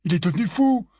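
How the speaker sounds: high-pitched alarm; terrified. A narrator's reading. Fred thinks George's mind is gone, terrified. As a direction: exclaim it in fright, voice rising. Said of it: "l'air terrifié".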